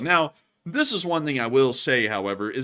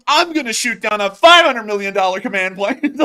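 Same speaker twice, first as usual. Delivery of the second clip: much higher and louder mock YouTuber hype; hammed-up boast; 8 semitones higher, 10 dB louder than usual. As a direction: announce it like a loud stunt video. Mocking Mister Beast boast.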